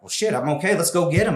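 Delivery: rally yourself to charge back in; pumped up, retelling the moment; retold fired-up rally. Retold sudden burst of energy.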